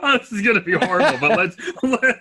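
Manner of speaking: high, fast, laughing mock horror; laughing mock dread. Co-host laughing at the threatened British accent, mock dread. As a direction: giggle through a teasing groan of dread.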